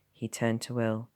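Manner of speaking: usual speaking voice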